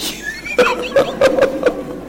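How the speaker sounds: breathless high laughter. Real, not acted. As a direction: break into helpless laughing. Laughing at own mule story.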